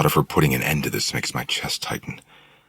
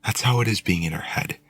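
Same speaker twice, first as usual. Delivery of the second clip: put-on higher young woman voice; Willow; 8 semitones higher than usual. A narrator's reading. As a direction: explain confidingly as a young woman. Willow explains, leaning in.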